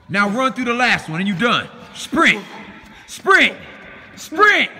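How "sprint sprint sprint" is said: building, high, loud shout. Real, not acted. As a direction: yell him into the last sprint. Coach yelling for a final sprint.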